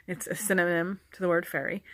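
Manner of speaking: usual speaking voice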